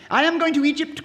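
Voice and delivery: high, loud call to everyone; crying out. Acted. Swallow crying out to sailors who ignore him. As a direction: announce it proudly to the harbour. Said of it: "cried the swallow".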